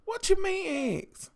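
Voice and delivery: rising to a high, stretched-out squeal of shock; high, slow shriek: "what you mean eggs"; shocked disbelief. Retold or imitated. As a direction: cry out in horrified disbelief. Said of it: "I lost my ever-loving mind".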